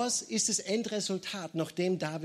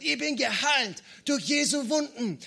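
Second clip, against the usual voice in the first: high-pitched raised cry of defiance; retold triumphant scream; 7 semitones higher, 3 dB louder than usual. Woman grown strong answering the voice, screamed back. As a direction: imitate her finally shouting it out. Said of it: "bis zum Schluss sie geschrien hat".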